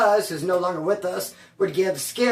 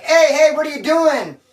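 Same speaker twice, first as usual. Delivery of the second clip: loud, high cop call-out; cop yelling; 8 semitones higher, 8 dB louder than usual. Retold or imitated. Cop catching him out of bounds, loud yell. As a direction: snap out a loud challenge. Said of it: "the cop's like".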